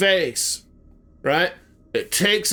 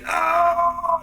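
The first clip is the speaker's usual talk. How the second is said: pained cry; 12 semitones higher than usual. Retold or imitated. Matthew bloody on ground.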